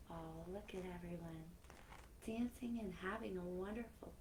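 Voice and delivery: gentle storybook reading with soft whispered lines; reading to children. Acted. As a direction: read it like a bedtime story to kids. Reading a picture book aloud.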